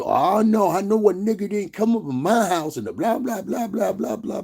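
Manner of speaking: very high, squawking mock outrage; hammed-up high-pitched fussing; mock indignant woman. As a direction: imitate a woman kicking off in falsetto. Mimics reacting like an indignant woman.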